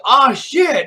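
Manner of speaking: loud, high yelp of alarm; imitated alarmed cry. Fireman as Michael Myers grabs his hand, alarmed cry. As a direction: act out a sudden shocked outburst. Retold fireman's horrified reaction.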